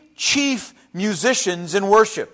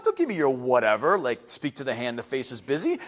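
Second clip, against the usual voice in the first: put-on sassy teen; 8 semitones lower, 7 dB quieter than usual.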